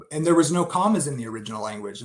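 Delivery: usual speaking voice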